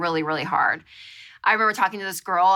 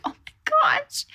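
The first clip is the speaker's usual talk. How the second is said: dismayed oh my gosh; slower than usual. Retold or imitated. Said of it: "And I was like".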